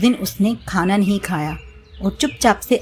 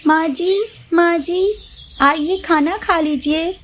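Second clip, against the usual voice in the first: higher, sweet calling voice; high bahu calling mother-in-law to dinner; daughter-in-law calling; 9 semitones higher than usual. Acted. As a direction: call her to eat sweetly. Mamta calls mother-in-law.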